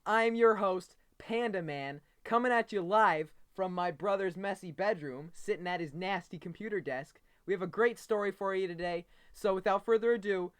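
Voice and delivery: upbeat mock anchor opening the show; playful kid newscaster welcome; news host intro. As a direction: greet the audience like a TV host.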